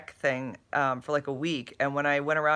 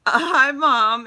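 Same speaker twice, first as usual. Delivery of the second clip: louder, slow, high greeting; excited drawn-out hello; 10 semitones higher, 10 dB louder, slower than usual. Real, not acted. Mother spotting her son in chat, drawn-out excited hello. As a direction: call out hello happily.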